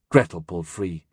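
ordinary delivery

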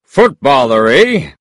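put-on Texan accent, questioning lift at the end; chatty Texan stranger sizing up his neighbour; Texan man. A narrator's reading. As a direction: quiz him in a Texan voice. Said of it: "said the large Texan man".

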